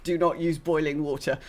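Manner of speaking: ordinary delivery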